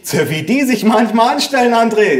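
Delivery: high-pitched mother's grumble; exasperated mother. A narrator's reading. Mother to son about the grandparents, exasperated.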